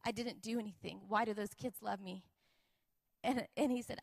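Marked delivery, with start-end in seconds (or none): 0.0-2.2 crying father. Retold or imitated. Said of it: "he starts to cry".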